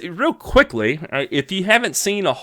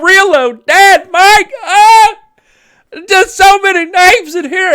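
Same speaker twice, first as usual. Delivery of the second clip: laughing; 7 dB louder than usual. Real, not acted. Overwhelmed by many viewer names.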